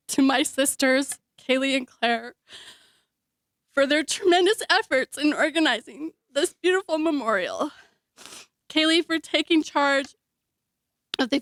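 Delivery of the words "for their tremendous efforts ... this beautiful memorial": usual speaking voice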